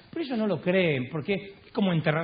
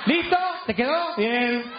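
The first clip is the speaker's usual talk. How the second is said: high, impatient call back; exasperated questions pitched up high; fed-up check; 5 semitones higher, slower than usual. Acted. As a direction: curtly make sure the stranger got it. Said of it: "Entonces Juan se cansa".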